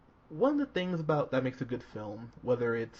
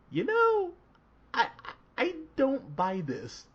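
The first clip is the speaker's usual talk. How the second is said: skeptical; 13 semitones higher, 4 dB louder than usual. Retold or imitated. Skeptical inner reaction quoted.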